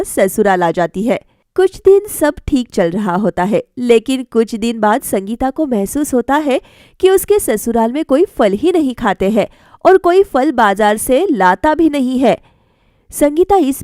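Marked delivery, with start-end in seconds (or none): none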